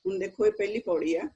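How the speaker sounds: ordinary delivery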